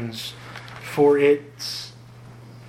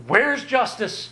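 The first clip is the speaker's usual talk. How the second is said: high, slow mocking whine; mock complaint; 9 semitones higher, slower than usual. Preacher mocking Israel's complaint, high and slow. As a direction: sneer at the hypocrites' demand. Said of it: "and yet at the same time saying".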